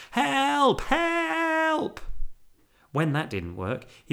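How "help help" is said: high, drawn-out loud calling; very loud cry for help. A narrator's reading. Bear stuck in bath, loud cry. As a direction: call out urgently, pitched way up. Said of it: "Then very loudly".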